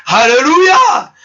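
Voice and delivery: joyful laughing praise; laughing alleluia. Real, not acted. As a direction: laugh your way into the Alléluia.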